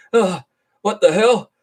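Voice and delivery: high, loud panicked yelps; hammed-up disgust turning to alarm; mock panic. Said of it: "he's like".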